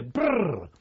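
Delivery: loud rolled brrr; sudden whirr of wings bursting from underfoot; imitated partridge take-off. Partridge startling the shooters from underneath their feet, imitated whirr.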